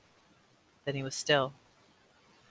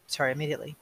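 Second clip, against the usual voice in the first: ordinary delivery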